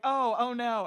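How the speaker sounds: lightly acted alarmed sympathy; retold dismay. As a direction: imitate friends realizing something is wrong. Friends react to her crying.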